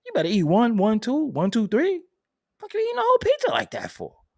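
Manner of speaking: mock scolding. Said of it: "I'm like".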